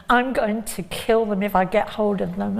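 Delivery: ordinary talk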